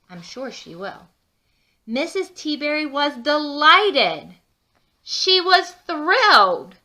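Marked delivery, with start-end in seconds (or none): 1.8-6.8 louder and higher, slow on thrilled; loud joyful read-aloud with thrilled drawn out; thrilled storyteller. Acted. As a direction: burst with delight over the cake. Mrs Teaberry's delight in story.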